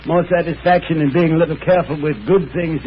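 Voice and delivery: ordinary talk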